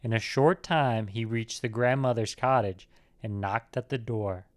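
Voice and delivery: usual speaking voice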